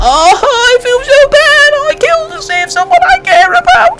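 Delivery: mocking the hero with shrill crying; mock wailing. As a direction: wail it like a crybaby. Mocking the hero's guilt.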